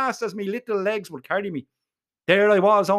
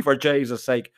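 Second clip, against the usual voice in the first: plain reading voice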